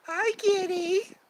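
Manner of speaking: very high, drawn-out greeting; squeaky, slow pet talk; cat-greeting voice. Real, not acted.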